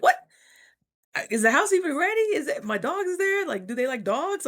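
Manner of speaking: high, fast, flustered questions; alarmed questions. Retold or imitated. Retelling her panic on hearing a roommate is moving in, high and fast. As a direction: rattle off worried questions in a high voice.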